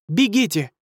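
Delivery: urgent high shout. Acted. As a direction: yell at them to run. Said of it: "крикнула Алиса".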